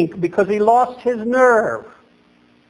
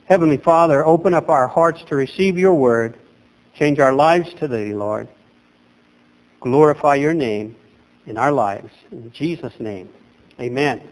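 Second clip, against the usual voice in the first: prayer; 5 semitones lower than usual. Real, not acted. Pastor praying to open the sermon, reverent. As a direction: pray it humbly to the Lord.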